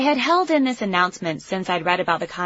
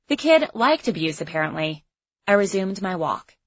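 ordinary delivery, nothing marked

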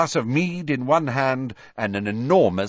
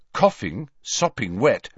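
ordinary delivery, nothing marked

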